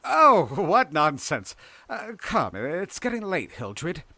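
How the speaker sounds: high, loud character voice, easing off; scoff, then coax them away; dismissive coaxing. A narrator's reading. Lewis dismisses and coaxes Hildred.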